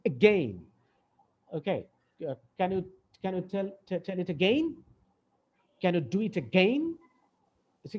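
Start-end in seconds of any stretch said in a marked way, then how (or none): 0.0-0.6 again with a tiny "a" and a falling, stressed GAIN. Shown as a demonstration. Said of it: "শুধু গেইনটা শোনা যাচ্ছে". 6.4-6.8 again with GAIN pressed and rising as a prompt; stress on GAIN. Shown as a demonstration. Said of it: "আমি ওখানে pressure দিচ্ছি".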